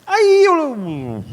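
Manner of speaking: high, loud, rising cry; outburst. Real, not acted. Pastor insulted on the road, high loud outburst. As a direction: let the anger burst out.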